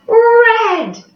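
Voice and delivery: eager answer call; excited call. Acted. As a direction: call it out for the kids. Calling out answer for class.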